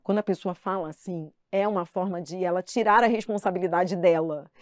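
usual speaking voice

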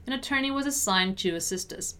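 brisk natural-speed read; conversational-speed model sentence. Shown as a demonstration. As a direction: link the words and move quickly. Teacher repeats at quicker pace.

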